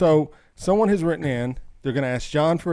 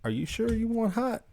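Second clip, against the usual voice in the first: 1 imitating the doubtful waitress; 9 semitones higher, 5 dB quieter than usual. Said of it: "she goes".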